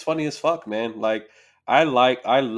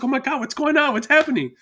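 high, startled waking voice; startled wife; 13 semitones higher than usual. Retold or imitated. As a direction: jolt awake confused. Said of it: "she'll wake up like".

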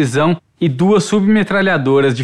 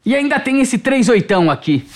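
higher, boastful guard voice; proud; 8 semitones higher than usual. A narrator's reading. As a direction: show off one more with pride. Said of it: "O segurança falou com orgulho". (com orgulho, se exibindo)

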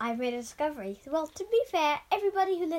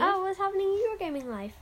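sarcastic; 7 semitones higher than usual. Acted. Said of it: "Wow, sheesh".

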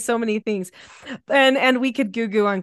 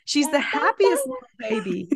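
doting coo; 10 semitones higher than usual. Real, not acted. Mother cooing over baby.

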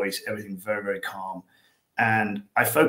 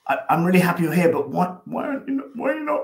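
mock confused owner, pitch climbing higher toward the end; puzzled owner; 6 semitones higher, 4 dB louder than usual. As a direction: play the baffled owner, rising into a squeaky question. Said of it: "the owners look at me going".

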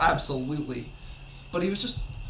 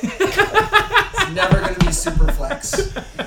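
laughing; 7 dB louder, much slower than usual. Pretend. Mock declaration, laughing.

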